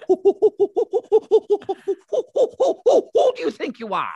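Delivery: monkey-like high, loud hooting; [name]. Acted. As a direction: hoot the words like an agitated monkey caricature. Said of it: "And I go".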